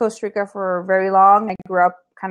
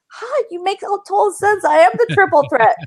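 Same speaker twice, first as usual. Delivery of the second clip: quoted self; 11 semitones higher than usual. Retold or imitated. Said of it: "I'm like".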